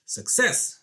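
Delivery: loud, high burst of triumph; triumphant exclamation. Real, not acted. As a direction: celebrate a small victory out loud. Celebrates getting sticker off.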